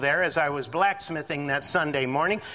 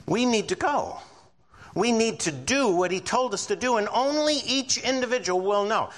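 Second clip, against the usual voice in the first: raised, fervent preaching; 7 semitones higher than usual. Real, not acted. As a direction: preach it out high and strong. Preaching at full force.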